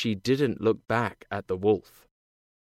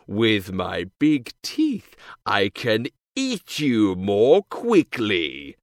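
slow, loud menacing wolf; 3 semitones lower, 4 dB louder, slower than usual. A narrator's reading. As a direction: growl it out, slow. The bad wolf.

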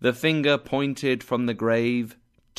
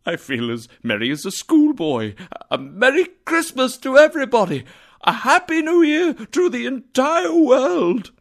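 high, fast joyful shout; happy tears; 13 semitones higher, 6 dB louder than usual. A narrator's reading. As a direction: burst out with giddy joy. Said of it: "laughing and crying at the same time".

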